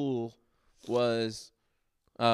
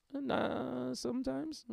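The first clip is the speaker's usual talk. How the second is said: wife's teasing reply; 13 semitones higher, 7 dB quieter, much slower than usual. Retold or imitated. Voicing the wife's teasing answer.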